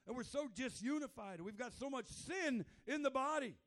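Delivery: loud, high pleading sermon voice; fervent preacher pleading; impassioned preaching. Acted. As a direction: cry out to God in anguish. Preaching at full force.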